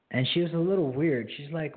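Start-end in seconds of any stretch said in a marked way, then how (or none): none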